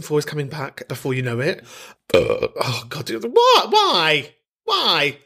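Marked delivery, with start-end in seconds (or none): 2.1-5.2 startled, high "God, what?"; exasperated, rising "Why?"; surprised. Real, not acted. Tech trouble, sudden exasperation.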